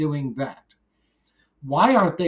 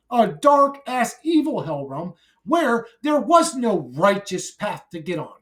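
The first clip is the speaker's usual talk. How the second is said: angry rant; 7 semitones higher than usual. Acted. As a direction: spit the words out in rage, shouting them down. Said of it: "a angry rant".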